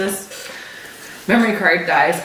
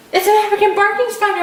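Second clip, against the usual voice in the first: high, fast urgent warning; mock alarm; 12 semitones higher, faster than usual. Husband fooling his wife about a fake spider, high and fast.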